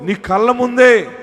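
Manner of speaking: high, loud proclamation; fervent sermon climax; full-force preaching. Real, not acted. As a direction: declare it to the whole congregation like a herald.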